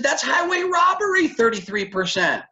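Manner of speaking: very high, indignant mock shout; acted outrage. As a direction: cry it out like a scandalized young hothead. Acted outrage at lender's rate.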